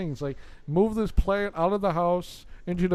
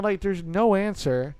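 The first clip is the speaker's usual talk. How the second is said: plain reading voice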